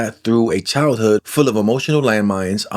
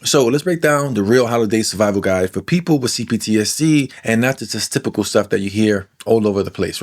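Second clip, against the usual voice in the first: usual speaking voice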